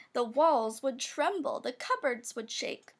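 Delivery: usual speaking voice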